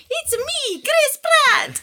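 very high, loud squeaky Mario voice; high Mario impression. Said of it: "I kept going up to Dad going".

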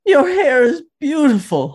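high, laughing fond voice; doting mom. Retold or imitated. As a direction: gush over him like an older mom. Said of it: "She's like".

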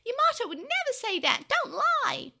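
high, loud outraged scream; screamed protest. A narrator's reading. Tokomon defends Yamato against Devimon's tale, screaming in protest. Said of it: "Tokomon screamed at Devimon".

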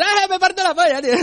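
very high, hammed-up laughing shriek; mock Taliban hysterics. Comic plays all the Taliban dying of laughter, shrieking hysterics. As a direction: crack up helplessly in a squealing put-on voice. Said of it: "todos los talibanes".

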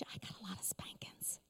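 hushed comic aside before a laugh; joking whispered aside. Real, not acted. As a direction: whisper it like a sheepish secret. Joking confession, dropped to whisper.

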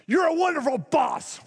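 high-pitched, yelled compliment; hammed-up angry shout of praise; mock yelling. Shown as a demonstration. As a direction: yell praise as if furious, for comic effect. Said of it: "say it loud and you're yelling it".

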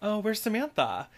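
high-pitched, perky mock hello; imitating energetic greeting. Acquaintance comes over to the girls, imitated energetic greeting. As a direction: play someone bubbly popping over to the table. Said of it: "funny, energetic".

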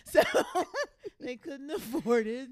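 laughing while talking. Real, not acted. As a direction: giggle through the words. Laughs through her words.

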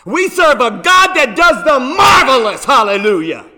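loud exultant preaching. Acted. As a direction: proclaim it with full force. Preaching at full force.

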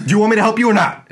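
high, loud stern demand; mock stern cashier. Cashier snapping at the rude customer: loud mock stern. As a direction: snap at the customer impatiently.

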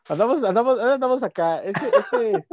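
high laughing voice; giggling through it. Real, not acted. Laughing at own joke.

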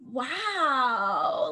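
long drawn-out, swooping wow; stretched mock amazement; awed wow. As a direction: play an impressed kid marvelling. Imagined young relatives admire old photo.

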